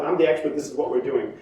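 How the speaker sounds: low, firm expert voice; quiet authority. Acted. Expert doctor telling patient the plan, firmly authoritative. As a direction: state it low, as settled fact. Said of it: "have authority and tell you".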